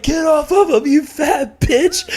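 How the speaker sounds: high, slow hammed-up yell, laughing; mock yell. Drunk friend yelling at the girl, high and slow. Friend kicking door in story.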